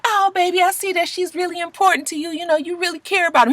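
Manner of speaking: high, syrupy mock-sweet mother; high sugary motherly voice; sweet mama voice. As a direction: coo it like a fake-nice mom. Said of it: "She's just like".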